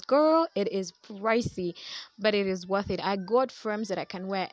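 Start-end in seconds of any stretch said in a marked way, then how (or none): 0.0-1.7 sassy. Real, not acted. Sassy aside to viewer.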